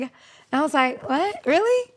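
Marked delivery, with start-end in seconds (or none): 1.1-1.9 surprised. Retold or imitated. Hearing surprising news about herself.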